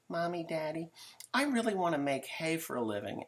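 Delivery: high pleading mock child voice; whiny rich kid. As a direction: play the spoiled kid asking mommy and daddy. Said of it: "he says".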